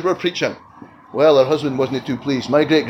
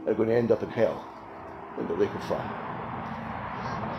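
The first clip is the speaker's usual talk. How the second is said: slow near-whisper, dropping away; hushed dread; 5 semitones lower, 12 dB quieter than usual. Real, not acted. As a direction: let the words sink to a breath. Sermon warning of hell.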